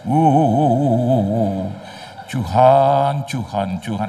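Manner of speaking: slow swooning cries then panting wuh; mock ecstatic prayer. Mocking a worshipper lost in ecstatic prayer. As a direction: overplay a worshipper caught up in feeling. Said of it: "siapa yang dia panggil?".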